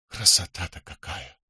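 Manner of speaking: soft awed whisper. A narrator's reading. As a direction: breathe it out in wonder. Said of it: "прошептал он". (восхищённый шёпот)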